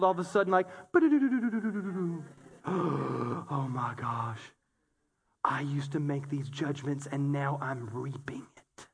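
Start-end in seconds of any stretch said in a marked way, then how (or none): 0.9-2.2 sharp gasp. Retold or imitated. Sudden realisation. 2.7-8.9 much lower and softer, slow, stunned; a hushed, dawning "oh my gosh"; stunned realisation. Retold or imitated.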